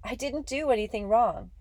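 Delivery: high-pitched protest of innocence; begging. A narrator's reading. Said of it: "I begged".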